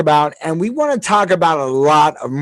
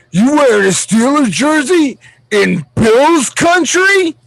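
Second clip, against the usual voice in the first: mocking Bills fan; 8 semitones higher, 3 dB louder, slower than usual. Dude confronting him, retold.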